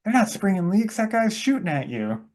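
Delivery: high-pitched mock warning; imitated gas station owner. Gas station owner warns about the shooter, mock alarm. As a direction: voice an urgent bystander, played for laughs. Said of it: "the owner of the gas station is like".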